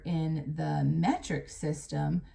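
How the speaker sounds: ordinary delivery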